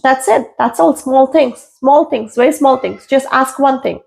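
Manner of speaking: usual speaking voice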